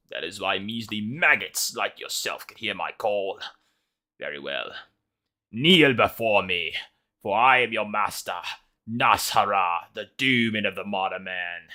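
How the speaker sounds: slow, loud, commanding doom voice; booming demon lord. Acted. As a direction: make them kneel before you. Wizard announces himself as master.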